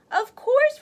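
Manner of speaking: builder replying. Acted. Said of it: "replied the builder".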